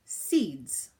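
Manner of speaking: ordinary delivery, nothing marked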